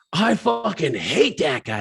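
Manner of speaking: high, slow, put-on disgust; grumbling regulars. The regulars sneering at the old guy, high and slow. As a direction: drag it out like a sour old regular. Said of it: "Everybody's like".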